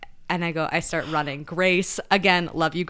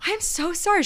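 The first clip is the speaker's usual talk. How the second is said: much higher, emotional apology; gushing apology; 11 semitones higher than usual. Retold or imitated. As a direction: gush the apology, overwhelmed.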